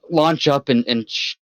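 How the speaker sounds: ordinary delivery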